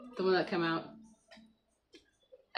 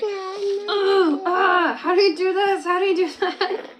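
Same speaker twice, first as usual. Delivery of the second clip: high, loud imitation of a frustrated child; mock-frustrated wail, pitched way up; frustrated little kid; 10 semitones higher, 7 dB louder, slower than usual. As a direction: mimic a child worked up over the computer. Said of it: "he gets so frustrated".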